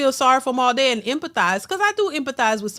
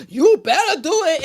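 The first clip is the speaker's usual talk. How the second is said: mock demand; 4 semitones higher, 4 dB louder than usual. Mimics women shaming men.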